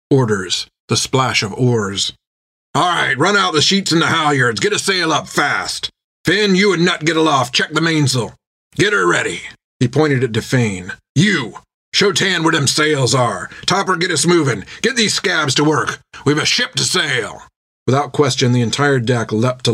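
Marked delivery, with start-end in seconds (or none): 2.7-9.4 higher, urgent captain's commands; brisk sea captain taking charge; captain giving orders. Acted. Jack orders the crew. 11.1-17.4 higher, urgent captain's commands; brisk sea captain taking charge; captain giving orders. Acted. Jack orders the crew.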